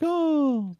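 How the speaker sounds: high, amazed little oh; wide-eyed child spotting merch; awed kid gasp. Retold or imitated. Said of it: "they're like".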